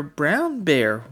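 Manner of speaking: ordinary talk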